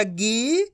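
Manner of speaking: गी stretched long, rising in pitch. Shown as a demonstration. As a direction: draw out गी on an upward glide. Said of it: "खींच खींच के पढ़ना है".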